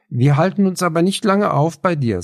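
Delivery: put-on determined girl setting her condition; firm and decisive. A narrator's reading. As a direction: lay down the rule firmly. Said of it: "sagte Clara bestimmend".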